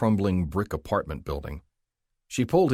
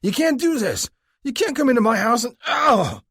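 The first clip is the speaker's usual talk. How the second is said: put-on drunk shout; 7 dB louder than usual. A narrator's reading. Drunk shouting at the arresting cop, furious. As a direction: bellow at the cop. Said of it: "the drunk shouted".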